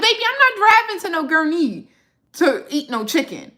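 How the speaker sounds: high-pitched sassy refusal; dismissive refusal. Retold or imitated. Creator retelling a refusal to drive out for chicken, dismissive. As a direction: brush them off in a high, incredulous pitch. Replying to owner's invitation, dismissive.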